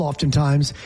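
ordinary talk

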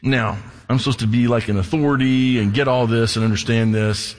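ordinary delivery

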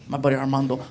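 normal talking voice

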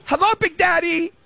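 put-on squeaky-high little girl greeting; Riley Kate. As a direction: imitate a small child answering a play phone, very high. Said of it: "she would dial and she'd go".

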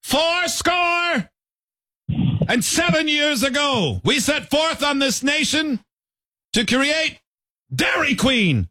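high, declaiming speechmaker with pauses; Abraham Lincoln. Acted. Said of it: "Abraham Lincoln".

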